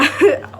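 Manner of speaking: short high burst of laughter; embarrassed laugh. Real, not acted. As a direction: laugh at your own silliness.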